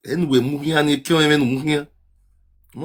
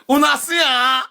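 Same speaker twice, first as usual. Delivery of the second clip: calling out; 8 semitones higher, slower than usual. Real, not acted. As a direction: shout it out high to the room. Calling out to live viewers.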